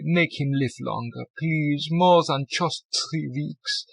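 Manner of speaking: put-on slow, begging German accent; tearful plea. A narrator's reading. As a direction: plead slowly with fake tears.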